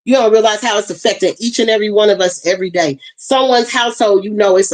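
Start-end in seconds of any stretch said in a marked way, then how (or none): none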